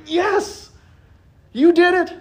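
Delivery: high, loud, thrilled praise; delighted cheer. Retold or imitated. God cheering his child who tried and failed, thrilled. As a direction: cheer like a proud father. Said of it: "he goes".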